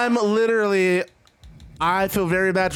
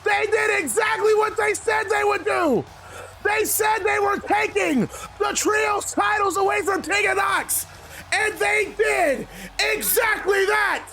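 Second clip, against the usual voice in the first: high, drawn-out victory shout; triumphant announcer call; 10 semitones higher, slower than usual. Real, not acted. Gamer announcing the new Trios champions, triumphant shout. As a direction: crown the champions like a ring announcer.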